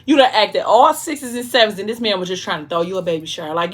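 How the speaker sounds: usual speaking voice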